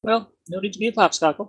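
put-on high-pitched, botched Russian accent; bad Russian accent. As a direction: attempt a Russian villager in a high voice. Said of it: "my best Russian accent".

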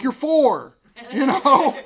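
loud incredulous parent; exasperated dad. Retold or imitated. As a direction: throw it back at him, half laughing. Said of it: "I'm like".

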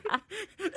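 high breathy laugh. Real, not acted. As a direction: burst out laughing. Laughing at the gore.

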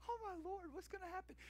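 put-on a child frozen watching on TV; scared kid. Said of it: "I'm like".

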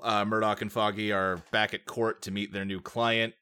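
ordinary talk

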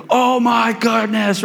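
high, slow starstruck gush; fangirling gasp. Retold or imitated. Disciples seeing Moses and Elijah, starstruck gush. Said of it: "they're just fangirling crazy".

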